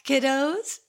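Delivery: ordinary delivery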